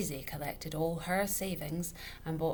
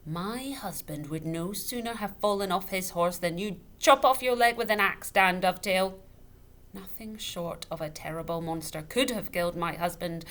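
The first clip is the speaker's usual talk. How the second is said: angry warning; 3 semitones higher, 8 dB louder than usual. A narrator's reading. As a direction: make it a threat. Widow's angry warning builds.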